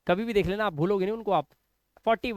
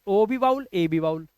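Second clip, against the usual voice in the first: ordinary talk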